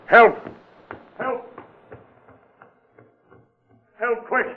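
urgent high calls for help; calling for help. Acted. Police chief calling others after the shooting, urgent shouts.

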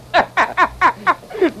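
high gleeful cackle of laughter; delighted cackle. Acted. O'Shea to the doctor, delighted cackle.

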